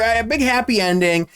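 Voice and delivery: ordinary delivery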